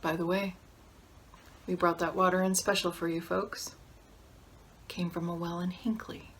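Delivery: low, soft delivery dropping away; understated, softly spoken jab; quiet pointed aside. Acted. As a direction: drop the voice for a cool afterthought. Acted pointed aside after a threat.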